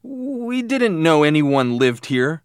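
high, slow, frightened stammer; trembling. A narrator's reading. Boy answering the angry stranger, trembling. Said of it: "I was trembling, but I tried to answer".

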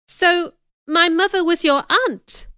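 high, loud disbelieving question; can't quite believe it; stunned, high-pitched question; incredulous. A narrator's reading. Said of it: "I asked incredulously".

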